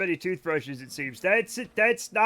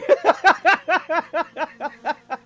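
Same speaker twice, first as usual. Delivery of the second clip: long high laughing fit, fading; helpless laughter; 8 semitones higher, 9 dB louder than usual. Real, not acted. Streamer apologizing to everybody, then helpless laughter. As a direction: laugh loud and high, tailing off.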